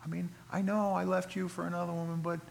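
a cheater begging to come home, coaxing; wheedling cheating spouse. Acted. Preacher playing the cheating husband, pleading softly.

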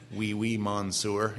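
slow French with a thick Maine accent. Shown as a demonstration. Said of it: "like I do with a Maine accent".

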